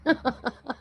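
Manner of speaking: high giggle bursting back out; real laughing fit. Real, not acted. Streamer still tickled by the troll's sudden ban, high giggling. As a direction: relapse into helpless giggles. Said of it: "It turned my guts".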